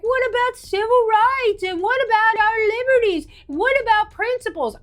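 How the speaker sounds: high, pleading mock of the right's complaints; sarcastic high mimicry of civil-liberties worriers; mocking whiny quote. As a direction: whine it like a naive do-gooder, sarcastic. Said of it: "they're like".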